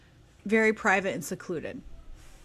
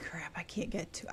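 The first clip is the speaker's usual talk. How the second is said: whispered mock frustration; 4 semitones lower, 14 dB quieter than usual. Husband's frustration retold.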